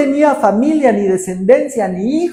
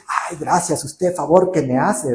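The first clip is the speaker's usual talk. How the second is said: mock polite thanks; 6 semitones lower, 6 dB quieter than usual. Polite thanks Gideon never said.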